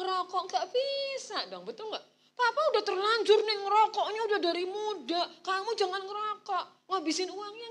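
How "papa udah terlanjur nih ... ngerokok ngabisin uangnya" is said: higher, louder lecturing dad; a smoking father preaching at his kids; mock father's nagging, pitched up and loud; hypocritical father.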